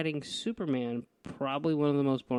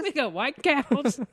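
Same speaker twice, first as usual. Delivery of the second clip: much higher, slow cowboy pitchman; 12 semitones higher, 3 dB louder, much slower than usual. Pretend. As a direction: copy the hammy cowboy sales pitch. Joins the cowboy salesman bit.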